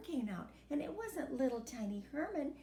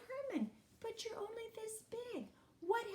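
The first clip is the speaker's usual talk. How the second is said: put-on surprised old man; 10 semitones higher, 7 dB quieter than usual. A narrator's reading. Old man surprised at tiny Herman.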